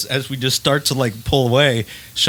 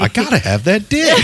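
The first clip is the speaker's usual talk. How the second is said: mock ringtone voice; 8 semitones higher than usual. Ringtone heard from the stall.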